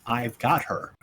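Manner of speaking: soft, low murmur; quiet reminder. Real, not acted. Player reminds the table of the earlier line, quietly. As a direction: say it low, almost to yourself. Unheard line, repeated afterwards.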